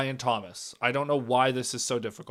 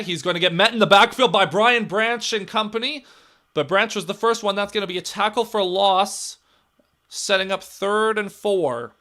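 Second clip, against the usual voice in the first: rising, louder play-by-play as the tackle lands; excited call; 5 semitones higher, 5 dB louder than usual. Real, not acted. Fan calling a tackle for loss, excited. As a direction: call the stuff in the backfield with a jolt.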